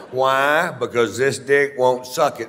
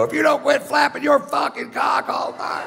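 much higher, big shout across the theater; shouting so seventeen hundred people hear; full shout. Retold or imitated. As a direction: belt it so the whole room hears. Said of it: "seventeen hundred people hear me go".

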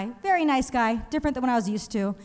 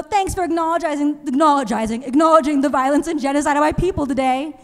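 louder mock-grateful sarcasm; sarcastic thanks; 2 semitones higher, 6 dB louder than usual. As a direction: thank her with dripping irony. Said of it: "I would go".

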